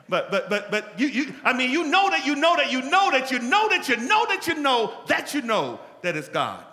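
loud, high, slowed shouting sermon; preaching at full force. Acted. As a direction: drive the point home at full force.

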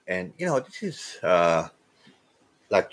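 normal talking voice

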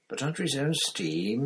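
a touch of eagerness; eager. A narrator's reading. Hermione eagerly reasons with Moody, mildly excited. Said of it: "said Hermione eagerly".

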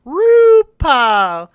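long swooping call; grand announcing. Pretend. Announcer-style drawn-out call.

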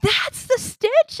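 high, loud, slow delighted shriek; mother shrieking at news of Stitch, loud and high; excited shriek. Retold or imitated. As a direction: shriek it like you just heard news of your favorite. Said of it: "and I shrieked".

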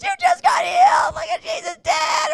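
shrieked, very high and loud; imitating a crowd member shrieking at the healing; ecstatic shout. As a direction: scream it like you just saw a miracle. Crowd films her miracle claim.

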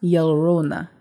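"Llorona" with LL as Y, yo-RO-na; Spanish Llorona. Shown as a demonstration. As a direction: start "Llorona" with a Y sound. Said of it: "L L is a Y sound".

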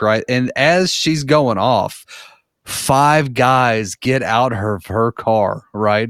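usual speaking voice

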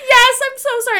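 high, loud retold near-crying wail; mock tearful apology. Storyteller apologizing to the stranger, mock tearful and high. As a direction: wail it as if starting to cry. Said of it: "I start, like, kind of crying again".

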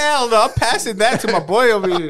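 laughing through the words. Real, not acted. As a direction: laugh while you talk. Laughing banter.

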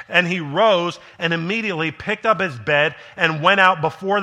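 loud, high-pitched preaching that climbs to the end; preaching at full force. Acted. Preacher rousing the congregation about Jesus' authority, full-force preaching. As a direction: drive the sermon home at full voice.

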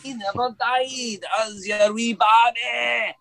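high, slow Scottish taunt; Scottish accent. Retold or imitated. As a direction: ham up the Glasgow brogue. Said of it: "the Scottish accent is not that easy to do".

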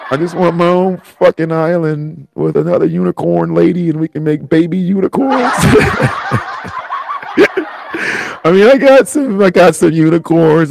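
high-pitched tearful wail breaking into laughter; real sobbing, voice shot way up; crying. Real, not acted. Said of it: "I'm crying like a bitch".

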